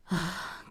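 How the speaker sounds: soft tired exhale; a long resigned sigh; weary sigh. Acted. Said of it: "Miss Bustier sighed".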